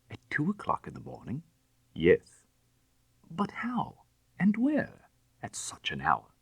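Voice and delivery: startled rising questions; incredulous. A narrator's reading. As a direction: ask in disbelief.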